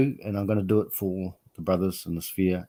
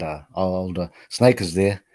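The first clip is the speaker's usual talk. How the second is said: ordinary talk, nothing marked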